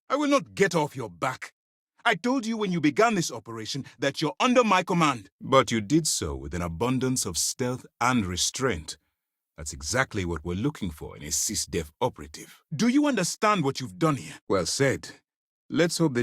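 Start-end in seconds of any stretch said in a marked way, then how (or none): 0.1-5.3 raised, high-pitched reprimand; heated assertion of command; angry commander. Acted. Commander asserts authority over subordinate. 12.7-14.3 angry confrontation. Acted. Commander confronts subordinate over deed.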